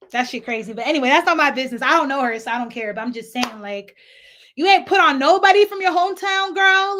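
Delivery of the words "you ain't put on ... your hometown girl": higher and louder, sassy; scolding a reality star, sassy and loud; sassy call-out. Real, not acted. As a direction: call her out with attitude. Calling out Natalie, sassy.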